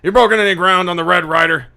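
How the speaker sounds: high, loud, rapid-fire grilling; shouting police captain. Acted. Captain demands progress on the case, angry shout. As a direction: fire questions like an angry boss. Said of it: "Jackson comes up to you and goes".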